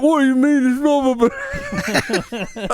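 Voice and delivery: very high, loud hammed-up British accent; British chimney sweep accent. Chimney sweeps in a commercial, shrieking British accent. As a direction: ham up a cockney sweep. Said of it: "they were all British".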